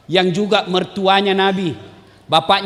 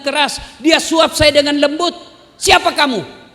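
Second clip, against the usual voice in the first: high, raised and accusing; indignant blind man; 7 semitones higher than usual. Acted. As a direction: push the stranger away, demanding who he is. Blind man rebuffs rough feeding.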